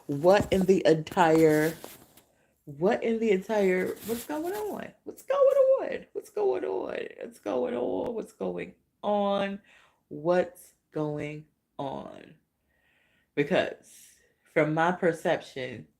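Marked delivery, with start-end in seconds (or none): 4.1-12.3 bewildered. Real, not acted. Repeated bewildered question.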